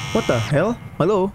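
usual speaking voice

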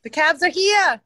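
put-on high, loud Jersey-accent holler; New Jersey accent. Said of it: "Can you do it in your accent?".

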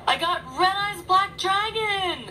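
high, drawn-out excited squeal; giddy high-pitched delight, words stretched; thrilled. Real, not acted. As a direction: announce a dream pull, overjoyed. Thrilled at getting the wanted card.

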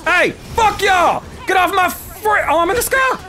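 high panicked yell. Real, not acted. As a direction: yell in surprise mid-air. Knocked into the air.

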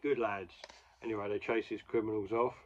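usual speaking voice

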